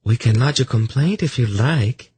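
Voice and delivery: high, lilting woman's voice. Acted. Velvet offering to lodge a complaint, high female voice. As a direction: lift it into a lighter female register.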